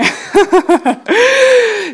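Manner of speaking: loud, high giggling fit; long high-pitched peal of laughter; bursting laugh. Real, not acted. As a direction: burst out laughing at your own remark. Bursts out laughing after joke.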